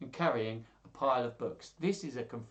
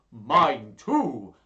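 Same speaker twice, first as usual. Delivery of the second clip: loud put-on interjection; hammed-up exclamation; insistent rival; 13 dB louder than usual. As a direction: pipe in loudly with a rival claim. Rival suitor chimes in.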